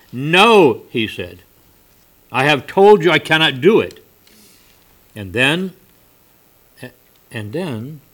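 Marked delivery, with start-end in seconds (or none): 0.1-0.8 loud, high sharp no; angry no. Acted. Merchant refusing the stranger a third time, loud angry no. 2.3-4.0 loud, high sharp no; angry no. Acted. Merchant refusing the stranger a third time, loud angry no.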